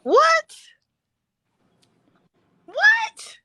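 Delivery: high, loud disbelieving squeal; can't believe what she just heard; shocked what. Real, not acted. Mom hearing the kids rock the TV, shocked squeal.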